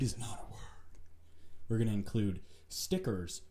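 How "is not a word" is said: a quick joking whisper off to the side; whispered aside. Real, not acted. Whispering to followers that occlude is no word. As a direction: drop to a whisper for the joke.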